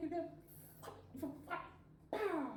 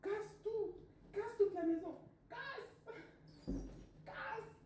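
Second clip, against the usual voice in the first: high, cheering parent voice; parents' voice; 10 semitones higher than usual. Retold or imitated. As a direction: egg her on, high and eager. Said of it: "Ils disaient".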